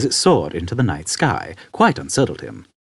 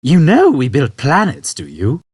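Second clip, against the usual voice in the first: put-on high, louder old man voice; 6 semitones higher, 5 dB louder than usual. Old man explaining to Arthur, high and unconcerned. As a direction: explain it matter-of-factly.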